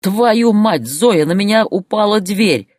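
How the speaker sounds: high, loud alarmed shouting; horrified yelling. A narrator's reading. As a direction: yell out in horror. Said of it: "с ужасом заорал он". (орёт с ужасом)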